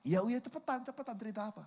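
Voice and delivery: high, softened exasperated whine; fed-up impatience. Acted. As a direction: hurry a rambling little brother along. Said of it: "kakaknya udah sebel banget".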